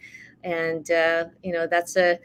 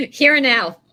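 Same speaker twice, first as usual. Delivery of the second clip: laughing, loud and high; laughing through words; 7 semitones higher, 10 dB louder than usual. Real, not acted.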